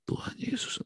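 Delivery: soft, breathless moan; whispered moan in the dark; moaning softly. Retold or imitated. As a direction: groan the name under your breath.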